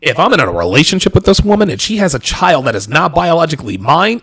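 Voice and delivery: usual speaking voice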